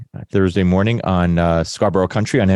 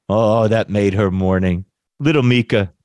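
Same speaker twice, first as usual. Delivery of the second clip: ordinary talk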